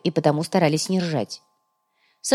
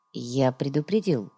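ramah tertahan; 5 semitones lower, 6 dB quieter, slower than usual. A narrator's reading. Mercenary warns grimly, low.

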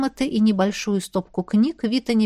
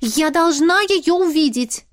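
enthusiastic; 6 semitones higher, 4 dB louder, slower than usual. Acted. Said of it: "пылала энтузиазмом".

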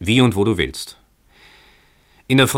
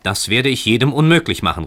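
raised, louder refusal; calling out; 6 semitones higher, 5 dB louder than usual. A narrator's reading. Tenant refuses the stranger from upstairs, calling out. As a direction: call down a firm refusal, pitch lifted. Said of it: "rief Heinrich".

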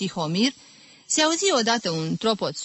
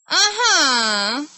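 high, loud gloating aha; diabolical glee; 8 semitones higher, 6 dB louder than usual. Acted. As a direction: pounce on the mistake with evil delight. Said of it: "se bucură diabolic". (bucurie diabolică)

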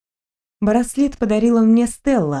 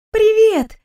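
much higher joyful hello; delighted greeting; 12 semitones higher, 3 dB louder than usual. Acted. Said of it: "Стелла обрадовалась".

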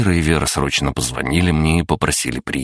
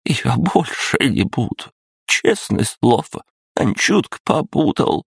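high, slow, whiny cowardly voice; pitiful whining; 10 semitones higher, slower than usual. A narrator's reading. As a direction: beg like a frightened child. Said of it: "жалко пролепетал Филимонов".

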